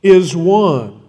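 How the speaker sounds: slow, weighty recitation of the Shema; solemn scripture reading. Acted. Preacher reciting the Shema to the congregation, solemn and weighty. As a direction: intone it like a sacred creed.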